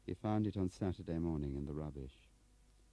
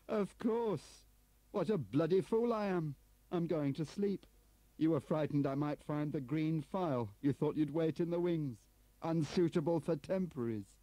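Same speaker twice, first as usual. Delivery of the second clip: high, loud mocking tirade; bitter outburst; 12 semitones higher, 7 dB louder than usual. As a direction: rail bitterly at yourself and them. Turner's bitter outburst at Delisle.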